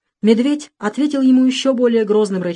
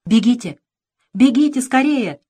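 louder, urgent cry to run; shouting; 4 semitones higher, 5 dB louder than usual. A narrator's reading. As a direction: shout a warning, building higher. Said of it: "закричал он, вскакивая". (кричит с тревогой)

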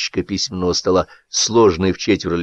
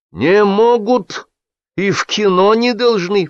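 high, emphatic angry agreement; heated; 13 semitones higher, 4 dB louder, slower than usual. A narrator's reading. Staffer heatedly backing boss against the botanist, vehement. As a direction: fire back vehemently, drawing out the start. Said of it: "в сердцах откликнулся Сапфиров".

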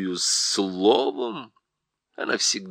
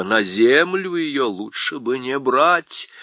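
ordinary delivery, nothing marked

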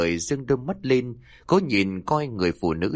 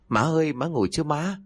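higher, calling through the door; 7 semitones higher than usual. A narrator's reading. Son knocking on his mother's door, calling higher. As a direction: call to mother at her door.

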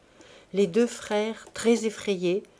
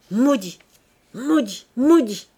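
loud, repeated cry of damnation; cried-out curse; 3 semitones higher, 7 dB louder than usual. A narrator's reading. Madwoman cursing her sons, repeated cries. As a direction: hurl each word out as a curse. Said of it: "elle criait et répétait".

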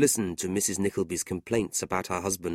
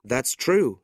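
lightly voiced young man, a little raised; proud young nephew; 5 semitones higher, 5 dB louder than usual. A narrator's reading. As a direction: concede it firmly, as a young man.

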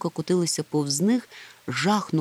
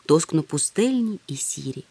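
usual speaking voice

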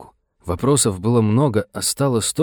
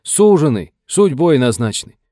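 louder, higher, irritated; cross correction; 5 semitones higher, 6 dB louder than usual. A narrator's reading. Older man explains the destined groom, cross. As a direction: correct him crossly and emphatically. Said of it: "осерчал Захар Ильич".

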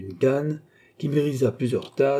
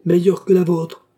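loud, raised furious retort; furious cry; 7 semitones higher, 8 dB louder than usual. A narrator's reading. Oliva defends her industry to Beausire, furious. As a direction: fling it back at the top of the voice. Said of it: "s'écria Oliva furieuse".

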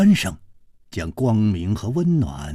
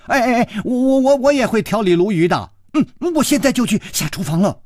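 high, fast, stammering excitement; excited young wife, pitched way up; bubbly, laughing eagerness; 10 semitones higher, faster than usual. A narrator's reading. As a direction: burst in eagerly, tripping over the first words. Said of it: "一面笑着说".

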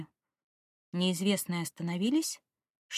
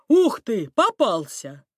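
surprised; 6 dB louder, slower than usual. A narrator's reading. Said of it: "не сдержал изумления".

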